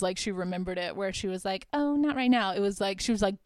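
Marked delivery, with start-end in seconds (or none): 1.7-2.5 higher, sweetly coy brush-off; mock sweet refusal. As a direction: imitate a gentle, sweet little no. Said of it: "it wasn't as sweet as".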